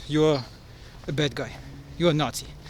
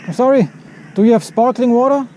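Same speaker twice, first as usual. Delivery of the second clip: louder and higher, making himself understood; loud to a shopkeeper; 5 semitones higher, 7 dB louder than usual. Real, not acted. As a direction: speak up over the counter to the shopkeeper. Asking a foreign shopkeeper.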